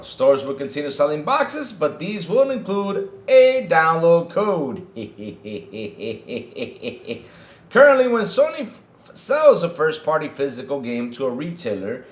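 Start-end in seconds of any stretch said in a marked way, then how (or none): 4.9-7.2 soft, low run of chuckles; snickering laugh. Real, not acted. Host reads about boxes with download codes, snickering laugh. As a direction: chuckle steadily under the breath. Mocking the download code news.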